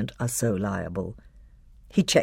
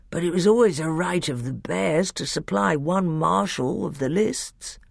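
drowsy bear, lifted in pitch; thick, sleepy voice; 5 semitones higher than usual. A narrator's reading. The bear claims his right as marshal, thick and sleepy. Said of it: "sleepy voice said".

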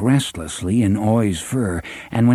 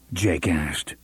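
plain reading voice

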